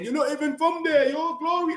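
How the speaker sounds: high, mocking put-on voice of a rival fan; mocking taunt. Streamer imitating rivals who taunt United fans, high mocking voice.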